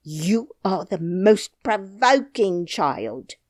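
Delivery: slow, loud exasperated cry. Acted. Nurse cries at the child, slow and loud. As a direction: drag it out in exasperation. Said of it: "cried the nurse".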